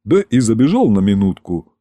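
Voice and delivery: usual speaking voice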